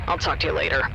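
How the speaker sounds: ordinary delivery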